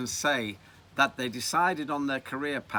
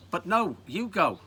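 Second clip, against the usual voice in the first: put-on raised-pitch boyish protest; acted squabble; 7 semitones higher, 4 dB louder than usual. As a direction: push the job back onto your mate. Boys argue over who goes.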